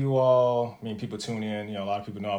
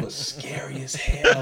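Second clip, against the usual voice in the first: half-whispered aside; slower than usual. Real, not acted. Talking about the scary dean.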